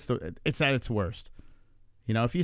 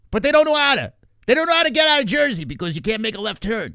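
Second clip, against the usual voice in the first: loud, high, hammed-up New Jersey accent; mock Jersey accent; 9 semitones higher, 5 dB louder than usual. Streamer mocks Jersey drones stuck without left turns, loud Jersey accent. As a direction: rant like a Jersey local who can't make a left turn.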